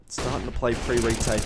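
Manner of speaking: ordinary talk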